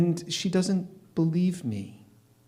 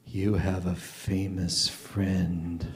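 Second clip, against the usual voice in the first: quoting coworker slowly; 7 semitones lower, 6 dB quieter, much slower than usual. Retold or imitated.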